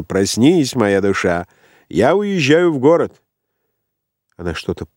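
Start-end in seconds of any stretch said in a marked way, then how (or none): 0.0-3.2 mildly cheerful wake-up call, rising in pitch; laughing call. A narrator's reading. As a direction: lightly rouse a sleeper, lifting higher towards the end. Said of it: "засмеялся".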